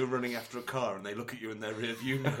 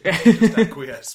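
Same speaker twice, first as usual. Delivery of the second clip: mock urging; 6 semitones higher, 10 dB louder, slower than usual.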